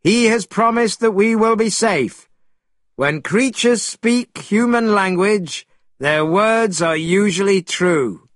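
high reassurance called to companions; calling out. A narrator's reading. Monkey assures the others of safety, calling out. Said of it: "called Monkey to the others".

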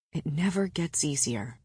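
low, very soft murmur; near whisper. A narrator's reading. Man sharing his grief before battle, almost whispered. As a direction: let it sink low and hushed. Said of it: "he whispered".

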